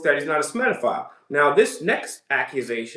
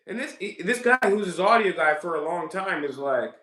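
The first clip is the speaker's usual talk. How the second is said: ordinary talk, nothing marked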